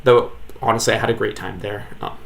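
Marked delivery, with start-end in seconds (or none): none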